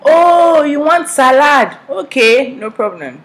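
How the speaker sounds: loud, high Nigerian exclamation of sudden understanding; Nigerian waiter. Retold or imitated. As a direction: burst out as a delighted Nigerian waiter. Waiter finally understands, acted out.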